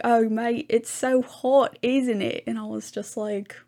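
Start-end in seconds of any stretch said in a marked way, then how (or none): 0.0-2.4 higher, louder mock Australian accent; second try at Australian. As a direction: another go at the Australian accent, pitched up. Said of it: "He was like".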